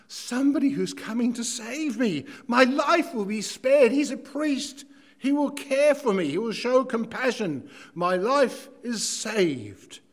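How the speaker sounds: injured traveller sees the priest coming; hopeful victim. Acted. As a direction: clutch at rescue. Injured man hoping for rescue.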